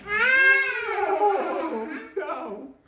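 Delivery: high wailing cry of despair; hammed-up sobbing oh; mock wail. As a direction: wail it like a frightened girl. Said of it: "Gretel began to weep bitterly".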